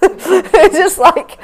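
words squeezed out through a high laugh. Real, not acted. Laughing at her own first reaction in the car, high and slow.

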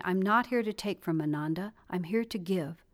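ordinary delivery, nothing marked

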